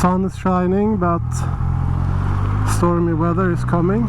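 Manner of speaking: talking through a motorcycle helmet; inside a helmet. Real, not acted.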